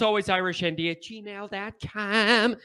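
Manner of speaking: ordinary delivery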